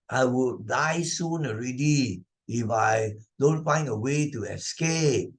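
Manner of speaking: normal talking voice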